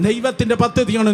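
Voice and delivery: normal talking voice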